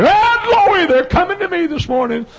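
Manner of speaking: high, loud joyful shouting of glory; Jesus having a spell. Retold or imitated. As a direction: shout it out like Jesus rejoicing in heaven. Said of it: "I can hear him".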